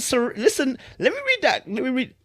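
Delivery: squeaky high mock voice of himself pleading. Mocking himself begging the writer to stop, squeaky high. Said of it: "Man said".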